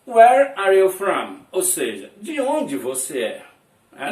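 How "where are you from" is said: slow, loud, clear "Where are you from?"; loud slow model reading. Shown as a demonstration. Teacher repeating the model question loudly and slowly for students. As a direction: project it slowly for the whole class. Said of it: "É assim que se faz essa pergunta".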